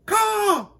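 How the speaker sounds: very high, loud crow screech; piercing crow call; crow caw. A narrator's reading. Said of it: "said the crow".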